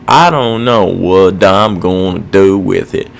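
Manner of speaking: ordinary talk, nothing marked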